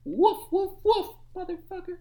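high, loud frantic barking; wild dog bark. Acted. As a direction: bark like a coked-up pug. Pug barking wildly after cocaine.

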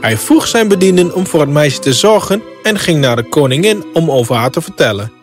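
plain reading voice

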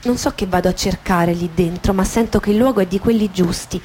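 usual speaking voice

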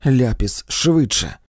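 a brisk call for the caustic, now; urgent command. A narrator's reading. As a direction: snap out the order to hurry. Urgent order at sickbed.

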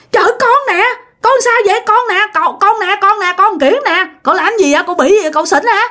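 high-pitched baffled protest; acted disbelief at the uncle's doubts; exasperated insistence. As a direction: keep insisting it's you, pitch jumping up. Exasperated nephew insists it is him.

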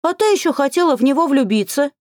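a high second fairy voice; light teasing pixie squeak. A narrator's reading. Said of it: "подхватила вторая".

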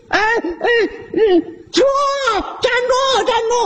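high yells while chasing; breathless-sounding high calls of stop; calling stop. Acted. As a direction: holler after the bike. Uncle chasing his stolen bike.

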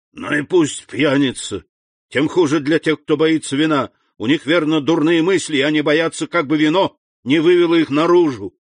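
put-on loud, high drunkard's bragging; blustering drunk Caderousse. A narrator's reading. Drunk Caderousse calling the innkeeper, boisterous. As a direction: boast tipsily, then holler for wine.

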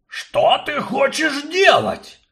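high, loud, slow dwarf voice; alarmed dwarf. Acted. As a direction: demand it, startled.

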